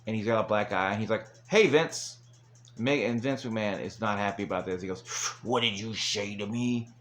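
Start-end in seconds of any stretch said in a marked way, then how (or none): 1.5-2.2 higher retold greeting; mock hailing. Mick Foley greets his boss backstage, mock hailing. 5.4-6.9 slow, put-on boss voice; mock menacing boss. Vince answers Foley's greeting, slow mock menace. Imitating the furious boss replying.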